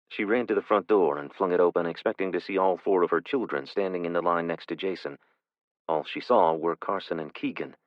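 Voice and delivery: plain reading voice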